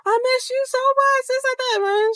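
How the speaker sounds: very high squeaky gushing voice; put-on high-pitched fake sweetness; mocking gushy impression. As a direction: mimic an over-friendly greeting in a piercing falsetto. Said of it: "Wicked Witch was just like".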